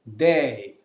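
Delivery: "they" as the French D, clipped day sound; French letter name. Shown as a demonstration. Teacher demonstrates French letter D to students.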